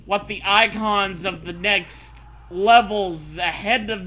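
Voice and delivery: steady read-aloud narrator voice; story narration. Acted. Reads the story text aloud.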